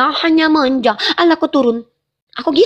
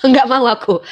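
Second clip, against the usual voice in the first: ordinary talk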